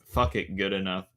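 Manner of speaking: normal talking voice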